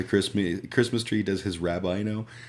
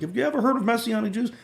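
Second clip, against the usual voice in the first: high, fast, eager uncle voice; imitating the uncle; 5 dB louder, faster than usual. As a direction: pitch it up like a keen recruiter. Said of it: "my uncle one day was like".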